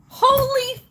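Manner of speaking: very high, tearful cries of disbelief; overwhelmed shock. Real, not acted. Live streamer asking Ducky what are you doing, overwhelmed. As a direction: wail in happy shock, stretching the words.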